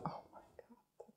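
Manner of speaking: stunned, barely audible whisper; awed whisper. Real, not acted. Friend stunned by a gift box, awed whisper. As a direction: whisper it in real disbelief.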